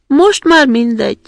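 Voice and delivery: put-on brash, loud robber girl; a wild girl being generous her own way. (harsány rablólány)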